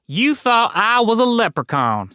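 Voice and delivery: high, sarcastic Texan voice. A narrator's reading. Leprechaun voiced with fake drawl.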